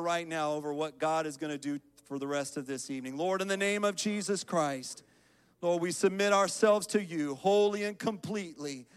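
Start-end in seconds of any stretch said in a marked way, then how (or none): 3.2-8.9 loud, raised-pitch public prayer; fervent prayer. Real, not acted. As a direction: call out to God on behalf of the congregation. Leads congregation in prayer.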